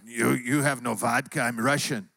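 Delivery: put-on low Russian-accented voice; put-on deep Russian accent; Mike the Russian. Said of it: "he always talks like this".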